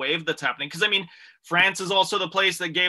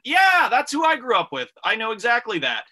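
higher, louder burst of recognition; excited audience member; 7 semitones higher, 5 dB louder than usual. Retold or imitated. Comedian's audience recognising who they grew up with, imitated excitedly. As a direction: imitate a fan eagerly recognising their own people.

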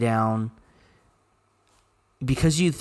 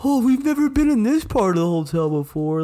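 mock drunk voice; 9 semitones higher, 4 dB louder than usual. Mocking drunk friend.

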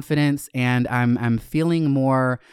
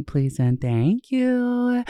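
sing-song please; 9 semitones higher, much slower than usual. Acted. Sing-song plea for five-star reviews.

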